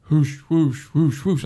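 quick run of whooshes; imitated whooshing. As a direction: mimic the approaching sound. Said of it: "all of a sudden I hear".

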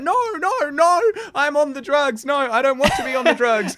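high-pitched mock panic; vanilla possessed freak-out. Mimicking the girl convulsing on drugs, mock panic. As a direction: wail it like a tame exorcism, very high. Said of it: "a really vanilla version of talking in tongues".

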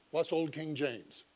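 usual speaking voice

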